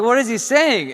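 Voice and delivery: high, loud imitation of a baffled mother; incredulous mom. Mom questioning the doctor on the phone, incredulous.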